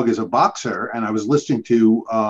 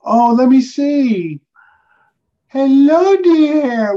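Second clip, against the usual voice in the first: much higher, slow cooing voice for a puppy; 13 semitones higher, 5 dB louder, much slower than usual. Real, not acted. Greeting the puppy on camera.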